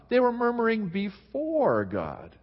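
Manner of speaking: they were murmuring before God, slow, the word before leaned on; stress on before. Shown as a demonstration. To the congregation, contrasting the changed word, slow stress on before. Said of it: "They changed the word".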